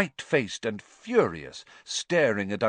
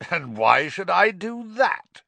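slow sneer, higher and louder; 11 semitones higher, 7 dB louder than usual. A narrator's reading. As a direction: drag out the contempt. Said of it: "sneered Uncle Vernon".

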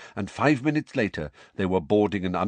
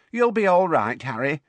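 high, louder encouragement; Tonks reassuring; 10 semitones higher, 5 dB louder than usual. Acted. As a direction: cheer him on.

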